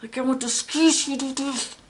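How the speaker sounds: high, playful squeezing growl; crunching-hug squeal. Retold or imitated. Reader voices a grandmother wanting to hug tight, playful squeeze. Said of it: "a crunching hug".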